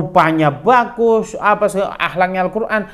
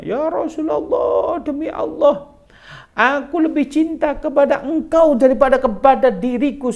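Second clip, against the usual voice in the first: loving confession; 11 semitones higher, 3 dB louder than usual. Retold or imitated. Companion embraces the Prophet lovingly.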